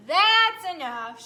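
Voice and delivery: loud, slow farmer's shout; angry farmer shouting at the cow. Acted. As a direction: yell it to stop the cow. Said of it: "shouted the farmer".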